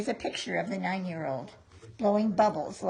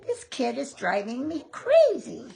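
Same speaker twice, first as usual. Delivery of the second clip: frustrated, louder mom voice; exasperated mother; 6 semitones higher, 6 dB louder than usual. Acted. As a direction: play the worn-out mother. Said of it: "Sometimes his mother would say".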